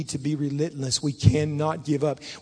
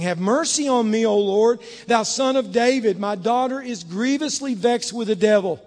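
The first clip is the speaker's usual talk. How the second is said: raised, preached plea; crying out; 5 semitones higher, 6 dB louder than usual. Acted. Woman of Canaan begging for her daughter, crying out. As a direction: cry out for mercy in a lifted voice. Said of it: "cried unto him, saying".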